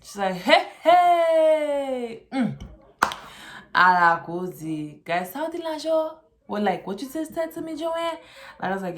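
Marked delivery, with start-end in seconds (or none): 0.4-8.2 high, loud Haitian mom voice; a mother scolding in thick Haitian accent. Retold or imitated.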